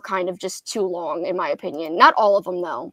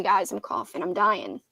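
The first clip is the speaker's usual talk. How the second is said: ordinary talk, nothing marked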